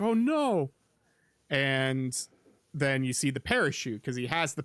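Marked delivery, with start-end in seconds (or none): 0.0-0.7 high, mock-alarmed "oh no"; a higher, hammed-up yelp of alarm. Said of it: "He's like".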